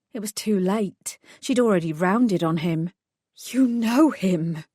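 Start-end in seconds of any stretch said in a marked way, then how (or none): none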